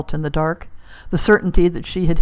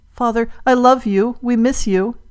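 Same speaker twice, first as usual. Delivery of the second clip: higher-pitched, heartfelt appeal; emotional plea; 6 semitones higher, 4 dB louder than usual. Acted. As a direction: call out to your lost father. Daughter calls to dead father.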